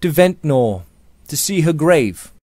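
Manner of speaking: distraught insistence. A narrator's reading. George sets off for his wife's grave, distraught and insistent.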